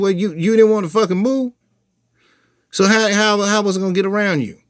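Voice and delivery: high, loud, fast indignant protest; exasperated protest. Retold or imitated. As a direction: defend yourself in disbelief. He protests after being hit.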